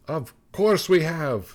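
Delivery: lightly voiced snow boy, playing along. A narrator's reading. Snow boy answers snow woman.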